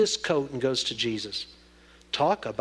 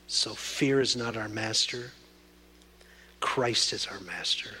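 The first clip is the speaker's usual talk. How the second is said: hushed prayer sinking to a whisper; 3 semitones lower, slower than usual. Acted. Closing prayer.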